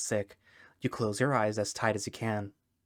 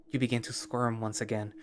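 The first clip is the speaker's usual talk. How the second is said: usual speaking voice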